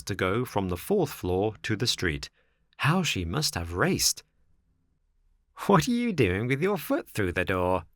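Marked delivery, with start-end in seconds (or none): 5.7-7.9 higher, lightly amused snail voice; laughing. A narrator's reading. Snail finds Pinocchio stuck in the door, amused. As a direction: ask with a touch of amusement. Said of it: "she asked the marionette, laughing".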